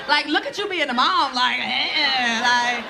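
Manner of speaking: imitating a whiny dramatic teen; mock extra daughter voice. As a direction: overdo the teen huffing eh and like. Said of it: "She'll be like".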